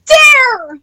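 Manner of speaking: punchy loud Dare; loud eager shout. Real, not acted. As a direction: shout the choice out. Answering the game.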